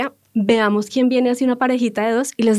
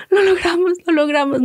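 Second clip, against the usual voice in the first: higher, louder cry of relief; joyful relief; 8 semitones higher, 3 dB louder than usual. Retold or imitated. Couple celebrating their boarding passes, high excited cry. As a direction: celebrate like you just made it.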